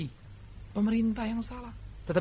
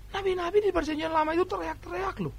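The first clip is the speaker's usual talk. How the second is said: ordinary talk, nothing marked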